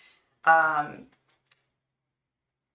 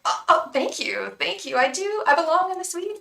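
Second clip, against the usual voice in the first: mock Swedish accent, higher and louder; imitating a drag queen's hammed-up Swedish accent; bad Swedish accent; 11 semitones higher, 6 dB louder than usual. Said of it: "she does a really bad Swedish accent".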